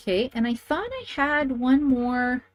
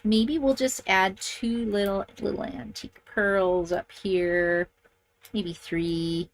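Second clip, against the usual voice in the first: normal talking voice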